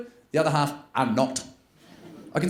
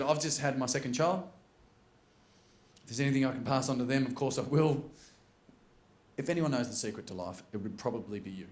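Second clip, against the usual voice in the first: soft, low, respectful request; hushed earnest appeal; 4 semitones lower, 8 dB quieter than usual. Retold or imitated. New parent asking an old billionaire for advice, hushed earnest appeal. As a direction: ask reverently, voice sinking.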